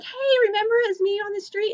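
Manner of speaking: high, perky imitated greeting; excited fan. Fan writing after meeting on the street, excited.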